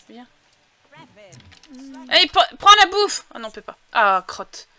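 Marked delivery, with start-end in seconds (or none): 2.1-3.3 loud, high egging-on; eager, impatient command; urging call. Real, not acted. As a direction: push the character to act, raised voice. Urges game character to take food.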